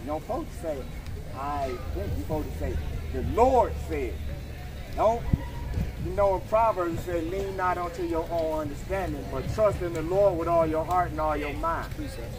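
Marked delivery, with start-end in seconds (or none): none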